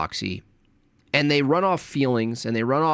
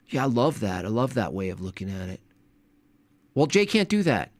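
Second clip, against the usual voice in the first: ordinary talk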